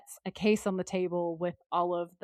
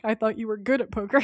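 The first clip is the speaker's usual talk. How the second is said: louder, quick mock disbelief in one go; incredulous quote; 3 semitones higher, 6 dB louder, faster than usual. Retelling Sam's funniest line, lightly incredulous. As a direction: blurt it out fluently as a punchline. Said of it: "that's the funniest line".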